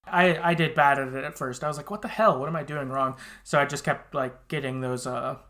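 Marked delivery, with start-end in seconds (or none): none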